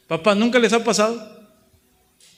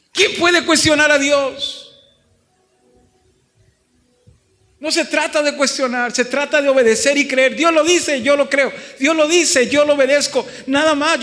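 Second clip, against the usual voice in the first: higher and louder pulpit call; fiery preaching; 8 semitones higher, 5 dB louder than usual. Acted. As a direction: drive it home to the congregation. Preaching at full force. (predicación con fuerza, más aguda y fuerte)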